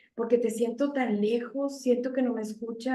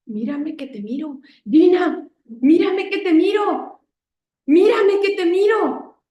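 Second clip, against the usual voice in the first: voice of the Lord; 6 semitones higher, 7 dB louder than usual. Retold or imitated. The Lord calling out to Dina, loud and insistent. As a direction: call her to look up, firm and loving. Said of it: "El Señor volteaba y me decía".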